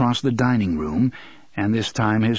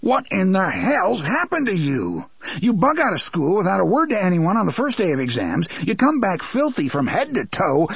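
exasperated accusation; 8 semitones higher than usual. Acted. Father bewildered and angry.

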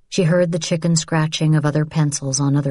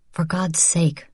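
usual speaking voice

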